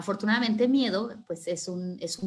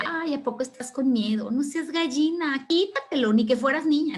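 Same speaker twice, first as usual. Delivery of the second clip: higher-pitched schoolyard teasing; mocking taunt; 5 semitones higher, 3 dB louder than usual. Classmates taunting a frightened boy.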